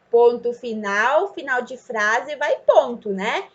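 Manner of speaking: ordinary talk, nothing marked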